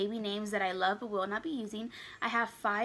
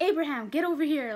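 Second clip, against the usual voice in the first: mock mom call; 6 semitones higher, 7 dB louder, faster than usual. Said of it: "can't picture myself saying".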